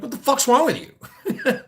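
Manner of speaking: half-laughing, half-exasperated; laughing retort. Retold or imitated. Streamer retells snapping at his partner, laughing as he says it.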